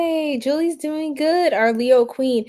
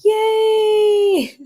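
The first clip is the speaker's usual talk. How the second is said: excited cheer; 9 semitones higher, 5 dB louder than usual. Real, not acted.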